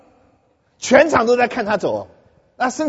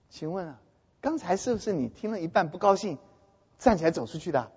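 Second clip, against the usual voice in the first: softer, very courteous asking; polite quiet question; 3 semitones lower, 11 dB quieter than usual. Retold or imitated. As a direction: ask her gently, almost tiptoeing. Speaker's own polite question to girl.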